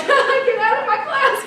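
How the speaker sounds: high, loud, laughing mock yell; teacher yelling. Teacher throwing the class clown out, high laughing mock yell. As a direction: throw the kid out with a laughing shout. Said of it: "The teacher was like".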